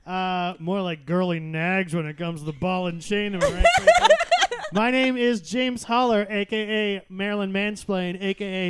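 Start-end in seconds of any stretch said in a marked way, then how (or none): 3.3-4.7 much higher, loud, laughing; cackling punchline. Acted. Host laughing at his own joke. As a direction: crack up at your own joke.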